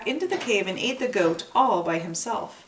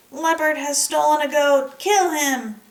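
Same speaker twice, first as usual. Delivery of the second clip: high, loud disguised shout; strange voice; 7 semitones higher, 7 dB louder than usual. Acted. Dog fakes villagers' alarm again, high loud disguised call. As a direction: call out in a put-on voice to scare him. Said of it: "calling out in a strange voice".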